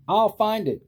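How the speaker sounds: an elephant vowing to the bird; cried out. A narrator's reading. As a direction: cry it out with resolve. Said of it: "cried Horton".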